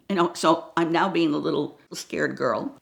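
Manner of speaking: ordinary talk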